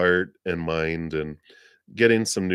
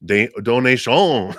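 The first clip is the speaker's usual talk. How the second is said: exaggerated French "donation", louder and higher; playful, louder mock-French delivery; French accent; 5 semitones higher, 6 dB louder than usual. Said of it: "That's my French accent".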